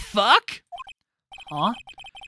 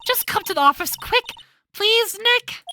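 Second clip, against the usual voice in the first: higher, urgent plea; pleading Maya; 13 semitones higher, 4 dB louder than usual. Acted. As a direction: beg him to hurry.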